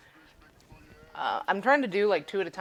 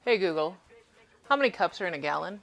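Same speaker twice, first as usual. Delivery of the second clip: louder, clearly projected question; raised voice for a device to hear; addressing voice assistant; 2 semitones higher, 6 dB louder than usual. Real, not acted. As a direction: put the question to a smart speaker. Asks the voice assistant after muttering.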